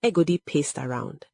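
ordinary delivery, nothing marked

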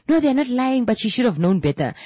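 high-pitched imitation of an indignant client; protesting client. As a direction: mimic a client arguing back, pitch up. Retold quarrel, client's protesting reply.

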